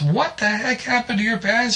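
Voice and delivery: high, shocked wife. Acted. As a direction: ask with alarm. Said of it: "she said".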